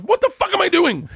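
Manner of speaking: high, panicked self-scolding. Retold or imitated. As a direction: freak out at yourself. Panicked inner thought retold.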